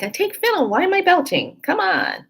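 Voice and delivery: high, fed-up complaining; exasperated whine. Retold or imitated. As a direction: whine it out in disbelief. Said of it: "I'm like".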